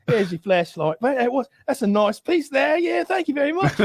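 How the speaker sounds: rising high, nervous mock thanks with a laugh; mock shaken cop. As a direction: thank him like a rattled, scared cop. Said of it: "shaken and disturbed".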